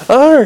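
a sudden loud, high yell of fright; startled yell. A narrator's reading. Vimes, waking to Nobby's face, yelling in alarm. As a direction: yelp out in shock.

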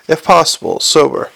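plain reading voice

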